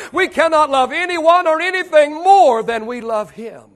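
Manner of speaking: rising sermon voice, slowed on the questions; preaching. Acted. Preacher pressing his church, building preaching voice.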